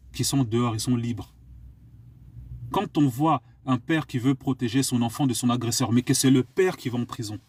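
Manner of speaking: ordinary delivery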